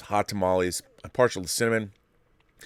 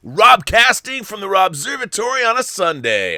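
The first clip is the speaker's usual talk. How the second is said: loud, high announcer holler; high-pitched, belted host catchphrase; booming show opener; 11 semitones higher, 7 dB louder than usual. Real, not acted. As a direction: shout the show's name to the audience. Show host's catchphrase intro.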